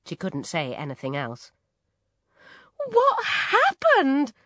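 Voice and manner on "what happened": very high, loud, drawn-out alarm; alarmed cry. A narrator's reading. Mother to her barking daughter, alarmed cry. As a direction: cry out in shock. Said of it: "cried her mother".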